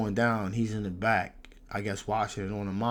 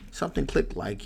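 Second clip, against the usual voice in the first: ordinary delivery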